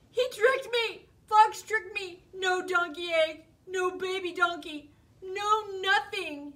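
high, loud dismayed wail; upset outburst. Acted. Bear realizing Fox tricked him, loud and dismayed. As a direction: rant in hurt frustration.

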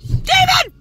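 alarmed shout. Real, not acted. Panicked at husband scraping her car.

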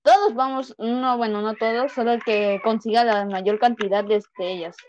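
normal talking voice

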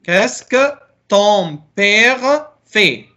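ordinary talk

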